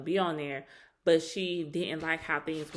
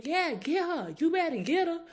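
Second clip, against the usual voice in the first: high, hyped mock cheer from the sidelines; a relative hollering encouragement; family egging you on; 9 semitones higher than usual. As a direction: pump them up like an auntie at a fight. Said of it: "they family telling them".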